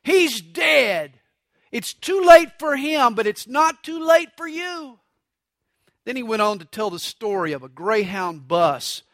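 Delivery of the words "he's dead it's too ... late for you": high, drawn-out country-preacher cry; pulpit-pounding preacher. Retold or imitated. Old country preacher warning mourners at a funeral, pulpit-pounding. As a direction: imitate a preacher hammering home a warning. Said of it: "The preacher pounded the pulpit".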